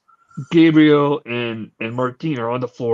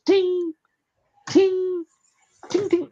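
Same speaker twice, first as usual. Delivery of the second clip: high sung ting ting of a bell; mock doorbell chime; slower than usual. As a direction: imitate a chiming bell up high. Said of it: "So he goes".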